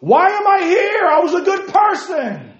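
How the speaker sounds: high, loud desperate shout from hell; anguished cry. Retold or imitated. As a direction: cry out like a damned man pleading. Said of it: "lift up his eyes being in hell and say".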